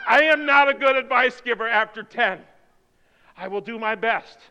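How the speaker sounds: ordinary talk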